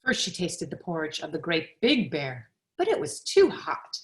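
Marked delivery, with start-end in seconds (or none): 2.8-4.0 too hot, up high. A narrator's reading. Much higher on too hot.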